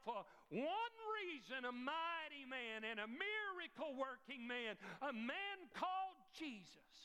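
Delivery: lightly voiced woman, rising with fervour; a hint of a thankful mother preaching her good news; grateful mother testifying.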